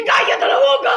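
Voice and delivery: high, loud furious yell of "¡Cállate la boca!"; shout. Acted. Hammed-up player shouting a teasing teammate down, in a furious yell. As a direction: blow up and shout him down.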